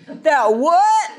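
indignant "say what". Retold or imitated. Teen student retorting indignantly to his teacher. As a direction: play the affronted teen.

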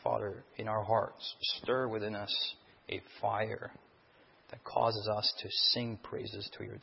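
soft, low pastor's prayer; hushed prayer before the sermon. Acted. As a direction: pray it quietly and reverently. Opening prayer.

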